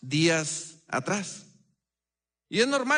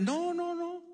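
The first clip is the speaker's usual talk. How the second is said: high, soft mock protest; imitated protest; 13 semitones higher, 5 dB quieter, slower than usual. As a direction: wave it off in a high, light voice. Retells friend waving away his worry.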